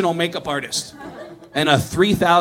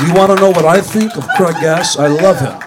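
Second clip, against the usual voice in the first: loud opening, mockingly self-important; lightly voiced impostor rock star, punched up; 3 dB louder than usual. Impostor answering for [name] down the hall, mock [name] voice. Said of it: "and he went".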